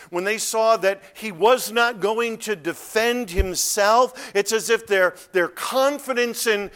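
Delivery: high, loud, insistent sermon voice; full-force preaching. Real, not acted. Preacher on the disciples' collapsed confidence, full-force preaching. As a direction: build hard and press every phrase home.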